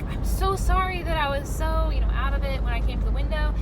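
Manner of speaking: high, loud, effusive apology; apologetic. Retold or imitated. Customer about to pull away apologises to the worker, apologetic. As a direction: imitate someone apologising profusely. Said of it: "she apologizes again".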